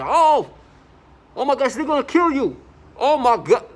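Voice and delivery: high, loud hammed-up alarm; mock panicked shout. Imitating people taunting him with fake panic. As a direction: shriek it like faked panic. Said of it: "they say".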